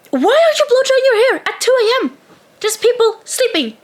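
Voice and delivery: high, fast, exasperated scolding; retold telling-off. Scolding the hair-drying girls at 2 AM, outraged. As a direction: tear into them, pitch way up.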